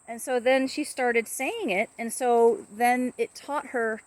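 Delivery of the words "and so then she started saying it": usual speaking voice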